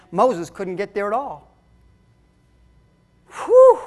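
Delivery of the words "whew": a sharp, high whistle of a whew. Real, not acted. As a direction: blow out a high, loud whew. Reacting to Moses' consequence.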